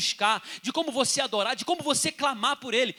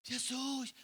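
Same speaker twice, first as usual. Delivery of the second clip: drawn-out, feeble call, fading softer; weak cry; 3 semitones higher, 8 dB quieter, much slower than usual. Acted. Bleeding woman too weak to shout to Jesus, feeble cry. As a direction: cry out with no strength, stretching the name. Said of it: "não tinha força pra gritar".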